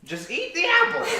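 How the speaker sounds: high cackling witch. Acted. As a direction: push the poisoned apple like a wicked witch.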